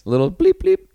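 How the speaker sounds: high, loud cartoonish squeak; squeaky silly voice. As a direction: pipe up like a cartoon character. Silly euphemisms continue.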